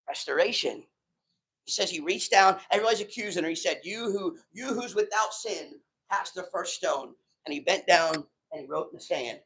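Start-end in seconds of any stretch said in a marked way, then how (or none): 3.8-7.1 softer, slower solemn words; hushed, measured challenge; quiet and grave. Retold or imitated. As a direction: calmly dare the crowd. Jesus quietly addressing accusers.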